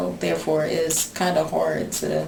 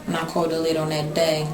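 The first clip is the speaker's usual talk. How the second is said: usual speaking voice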